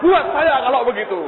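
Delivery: high, loud, excited claim; eager grabbing. Retold or imitated. Friend snatching the money from the table, eager.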